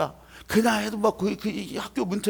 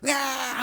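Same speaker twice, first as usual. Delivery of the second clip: drawn-out mock baby cry; imitated newborn wail; 4 semitones higher, 3 dB quieter, much slower than usual. Preacher mimics a baby just born, imitated wail. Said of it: "막 이제 막 태어났어요".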